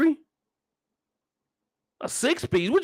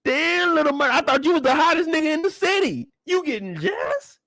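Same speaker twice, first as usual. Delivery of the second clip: teasing high voice; 6 semitones higher than usual. Retold or imitated.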